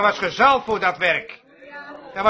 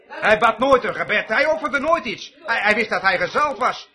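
usual speaking voice

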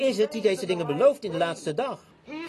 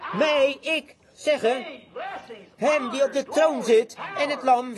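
fervent preaching; 4 semitones higher than usual. Acted. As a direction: lift the verse up to the rafters. Reads scripture at full force.

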